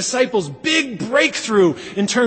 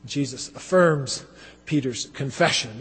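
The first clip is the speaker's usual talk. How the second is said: usual speaking voice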